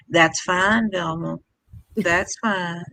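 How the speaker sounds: soft, measured grandfather voice; quietly over-calm imitation; imitated maddening calm. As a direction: placate softly, unruffled, letting it trail off. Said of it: "The calmer he got".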